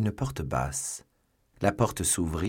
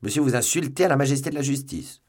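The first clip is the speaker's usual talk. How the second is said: raised, louder official outrage; indignant rebuke; 7 semitones higher, 5 dB louder, faster than usual. A narrator's reading. Commissioner accuses Athos of insulting justice, indignant. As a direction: rebuke him sharply, pitch lifted high.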